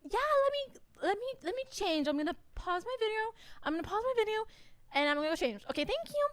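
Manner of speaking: high, rushed, nervous voice; flustered stalling. Retold or imitated. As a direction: stall nervously while trying to escape. Flustered self stalling the scammer.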